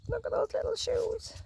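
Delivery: high soft cooing voice. Acted. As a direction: coo over the tiny shoes. Pointing at the scarecrow shoes.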